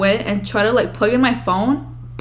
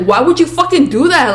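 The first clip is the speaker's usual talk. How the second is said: raised, loud outraged question; yelling, retold; 6 semitones higher, 7 dB louder than usual. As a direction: re-enact yelling at a sister. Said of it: "I remember yelling at her".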